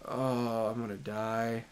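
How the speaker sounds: low, very soft groan of doom; hushed, sinking 'I'm done for' voice; resigned dread. Real, not acted. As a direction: murmur it low, as if doomed. Resigned dread at game danger.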